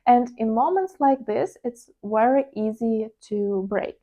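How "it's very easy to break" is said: ordinary talk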